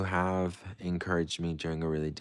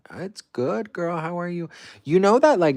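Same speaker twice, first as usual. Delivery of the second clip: put-on much higher, bubbly girlfriend chatter; chirpy high voice; 12 semitones higher, 8 dB louder than usual. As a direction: trill it like a best friend. High chirpy greeting to viewers.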